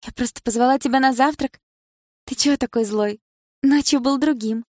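high, teasing woman's voice; ex-girlfriend taunting him about last night, high and coy; playful ex. Acted. Ex teases Lyokha.

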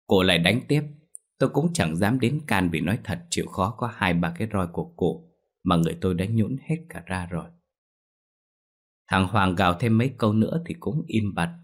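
usual speaking voice

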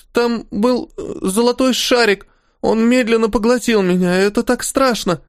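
put-on high, whiny child voice, still scared; whimpering little girl. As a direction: whimper it like a frightened little kid. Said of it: "красавица снова захныкала".

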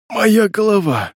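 moaning. Acted. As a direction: groan the words out in pain. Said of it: "Тихо простонала женщина".

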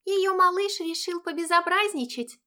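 put-on high girlish friend voice; chirpy young friend; cartoon princess. A narrator's reading. (высокий девичий голосок)

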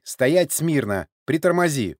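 loud, high drill command; barked orders. A narrator's reading. Sergei orders the old man and driver, barking. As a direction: order them sharply. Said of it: "вдруг неожиданно рявкнул Сергей".

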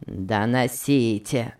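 slow, low, scornful; contemptuous. A narrator's reading. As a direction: sneer it dismissively. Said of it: "презрительно пощёлкивая пальцами".

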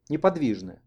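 usual speaking voice

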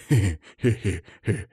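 slow, breathy he-he chuckle; laughing. A narrator's reading. Father amused after praising the lad, chuckling. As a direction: chuckle in spaced, acted bursts. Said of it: "засмеялся Матвей Романович".

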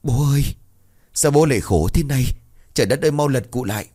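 put-on grieving children wailing over the coffin; tearful wailing. A narrator's reading. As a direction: cry out to the dead father. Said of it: "liền mếu máo".

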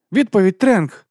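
high, loud insistent answer; exclaiming. A narrator's reading. Abby claiming the quiz answer from the teacher, exclaiming. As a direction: cry it out to claim the point. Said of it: "вигукнула Еббі".

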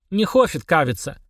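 mumbled through a mouthful; mouth full. Acted. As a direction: talk with your mouth stuffed. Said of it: "пробубнил Денис с набитым ртом".

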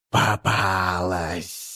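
slow, low inhuman hiss; a creature speaking through a human; parasite inside human voice. Acted. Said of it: "голосом личинки".

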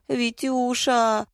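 high, drawn-out plaintive call; tearful wail. A narrator's reading. Wife crying to her scolding husband, tearful wail. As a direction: wail the pet name slowly, up high. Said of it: "заплакала Зоя".

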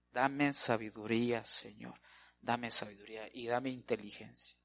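a murmured prayer asking for wisdom; hushed prayer. Acted. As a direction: pray it quietly, almost to yourself. Quoting a humble prayer to God.

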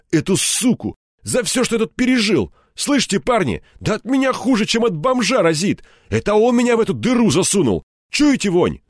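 high, boiling-over tirade; seething malice. A narrator's reading. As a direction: let the bitterness burst out line by line. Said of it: "злоба в нём клокотала и рвалась наружу". (с клокочущей, рвущейся наружу злобой)

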